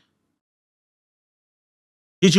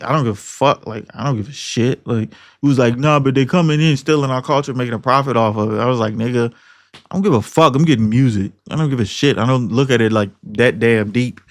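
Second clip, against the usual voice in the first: lower, softer shrugging dismissal; mock indifference; 8 semitones lower, 3 dB quieter than usual. His younger self brushing off culture-vulture talk, lower and dismissive. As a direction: brush it off like it's nothing.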